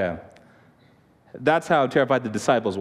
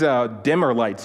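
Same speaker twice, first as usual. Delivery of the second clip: normal talking voice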